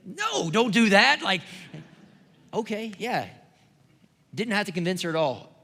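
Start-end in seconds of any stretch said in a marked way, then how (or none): 0.1-3.3 mock protest. Joking about the wife's expected answer.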